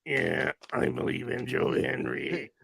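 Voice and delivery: very low, throaty commentator impression; put-on deep, hammy hype voice; Booker T. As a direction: drop deep and oversell it. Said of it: "we're trying to do his voice".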